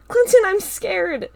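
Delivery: high, loud, slow frightened cry; scared scream. A narrator's reading. Carl cries out to Clinton, scared scream. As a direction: scream out your fear. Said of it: "Carl screamed".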